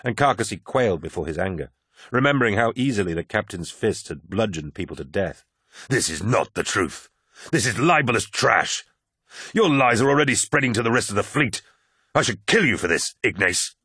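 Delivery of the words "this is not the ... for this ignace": cold fury. Acted. Loken threatens to kill the poet, anger mounting.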